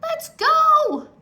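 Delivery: high, loud pleading yell; urgent shout. A narrator's reading. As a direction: yell at him to leave. Annie urging in storm.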